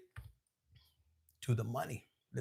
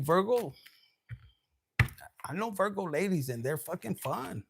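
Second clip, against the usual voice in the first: ordinary delivery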